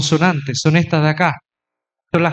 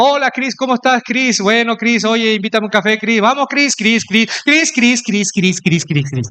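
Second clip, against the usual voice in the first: mock repetitive greeting; 4 semitones higher than usual. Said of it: "Es como si Chris, mi amigo, le digo".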